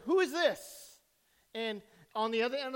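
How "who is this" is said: higher-pitched questioning caller; raised-pitch phone inquiry; telemarketer caller. Acted. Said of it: "And she said".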